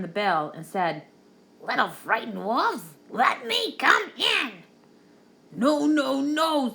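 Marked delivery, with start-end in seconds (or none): 1.6-4.6 menacing pig voice, drawn out and high; the villain pig wheedling at the door; big bad pig. A narrator's reading. Pig to the wolves at the door, sly menacing voice. 5.5-6.7 high, loud little wolves' refusal; frightened little voices saying no; little wolves. A narrator's reading. Little wolves refusing the pig, high and defiant.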